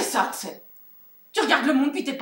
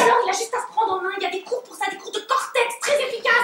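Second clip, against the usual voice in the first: agacée; 10 semitones higher, 3 dB louder than usual. Acted. Dispute animée entre amis.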